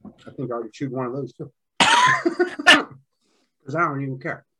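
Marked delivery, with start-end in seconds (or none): 1.7-4.4 laughing. Real, not acted. Laughing at his own recklessness.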